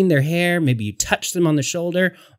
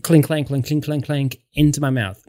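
ordinary delivery

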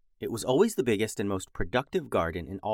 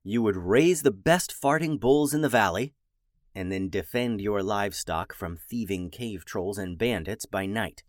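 usual speaking voice

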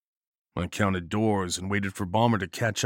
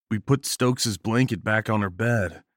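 usual speaking voice

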